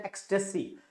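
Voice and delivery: ਐਕਸਟੈਸੀ said as EKS-teh-see; pronunciation demo. Shown as a demonstration. Teacher shows students how the word is pronounced, ਐਕਸਟੈਸੀ modelled as EKS-teh-see. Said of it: "ਇਹਦੀ pronunciation ਹੈ".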